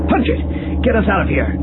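urgent. Acted. Urgent escape order.

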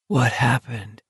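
soft, hushed question; softly. A narrator's reading. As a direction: ask it gently under your breath. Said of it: "Olsen asked softly".

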